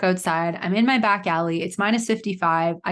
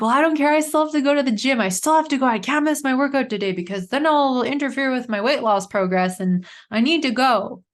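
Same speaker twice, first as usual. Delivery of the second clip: stubborn past self; 6 semitones higher than usual. Retold or imitated. Telling herself she must still go to the gym, high and determined. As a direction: push it through like nothing can stop you. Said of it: "I'm like".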